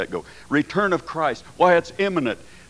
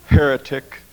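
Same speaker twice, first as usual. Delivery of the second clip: mock condemnation; 8 dB louder than usual. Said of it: "I said".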